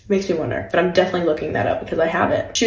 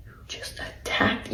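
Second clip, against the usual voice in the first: hushed, voiceless accusation; whispered disbelief at the attacker; whispered retort; 14 dB quieter than usual. Retold or imitated. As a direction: breathe it out in shock. Retold comeback, whispered.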